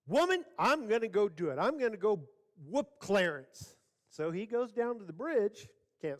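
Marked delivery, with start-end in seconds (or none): 0.1-3.8 higher, mock-defiant husband; blustering resolve. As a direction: announce it with comic bravado. Husband blusters back at his wife.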